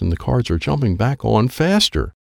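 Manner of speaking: usual speaking voice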